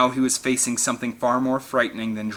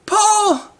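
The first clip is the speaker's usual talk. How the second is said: high, loud cry for her husband; anguished shout; 8 dB louder than usual. A narrator's reading. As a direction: call out in horror. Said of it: "Amanda shouted".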